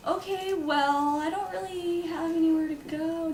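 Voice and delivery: high, slow and unsure; hesitant, timid reply. Retold or imitated. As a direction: draw it out like a stranded teenager. Stranded teenager answering the woman.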